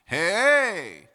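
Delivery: high, loud chest-voice Hey!. Shown as a demonstration. Coach calls out a chest-voice Hey!. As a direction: feel it buzz on the sternum. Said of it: "That's your chest voice".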